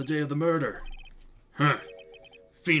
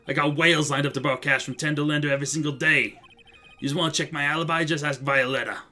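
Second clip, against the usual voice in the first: a hint of Brooklyn loan shark; Brooklyn accent. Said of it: "introduced a bad Brooklyn accent".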